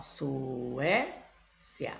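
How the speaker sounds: Suécia stretched very slowly, rising on the end; sounding out slowly. Shown as a demonstration. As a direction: spell out Suécia slowly for the class. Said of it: "Vai ficar".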